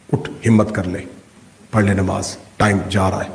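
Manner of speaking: low, subdued inner urging. Acted. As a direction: coax quietly in a low register. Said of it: "پھر اندر سے آواز آئی".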